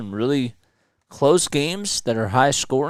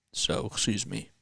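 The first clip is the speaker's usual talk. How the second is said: right after a burp, softly; 7 dB quieter than usual. Real, not acted. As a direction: excuse a sudden burp. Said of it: "burping all of a sudden".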